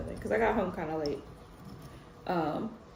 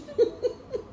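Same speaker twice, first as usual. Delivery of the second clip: high laughing fit; self-mocking laugh. Real, not acted. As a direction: laugh at yourself. Laughing at her own idiocy.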